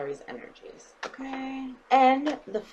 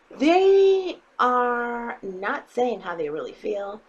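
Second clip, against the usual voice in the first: each word drawn out, slow and heavy; drawn-out emphatic reading; slow emphatic stress; 3 semitones higher, 5 dB louder, much slower than usual. Real, not acted. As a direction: lean hard on every word, slowly. Tarot reader stressing a message.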